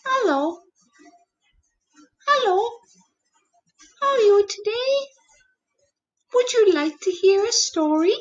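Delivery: high squeaky puppet voice. Acted. Teacher voicing a puppet, high and bright. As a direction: play a cheerful little puppet greeting kids.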